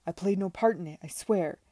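loud, emphatic oath; insistent denial. A narrator's reading. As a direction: swear innocence forcefully. Accused man swears his innocence.

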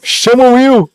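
high, loud call; shouting. Real, not acted. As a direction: yell across the room. Calling out across the room. (grito alto)

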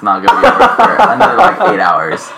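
can barely get the quote out for laughing; laughing mid-quote. Retold or imitated. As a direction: giggle through the retold complaint. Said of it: "He's like".

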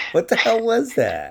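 ordinary delivery

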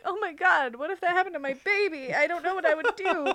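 high-pitched, rushed worry; imagined parental panic. Acted. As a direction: play a parent fretting over their baby. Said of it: "And I'm like".

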